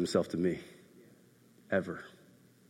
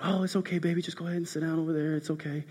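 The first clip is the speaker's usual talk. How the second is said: higher, soft voice of a patient dad; soothing parent; 7 semitones higher, 7 dB quieter, faster than usual. Retold or imitated. Dad gently sends daughter back to sit, reassuring. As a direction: softly reassure a small child, quick and hushed.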